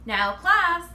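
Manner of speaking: high, loud, tight call to order; shrill and tight. Acted. Teacher calls the staring class to order, shrill and tight. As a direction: snap the class to attention, shrill. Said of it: "her voice shrill and tight".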